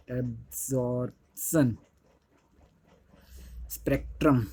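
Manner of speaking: Absorption spectrum drawn out syllable by syllable, slow and soft; slow spelled-out term. Shown as a demonstration. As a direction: say absorption then a long pause, then spectrum, very slowly and softly. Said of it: "इस वाले spectrum को absorption spectrum कहते हैं". (Absorption spectrum को धीरे-धीरे, नरम आवाज़ में, रुक-रुक कर बोलना)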